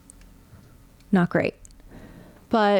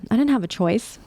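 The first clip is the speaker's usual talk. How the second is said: normal talking voice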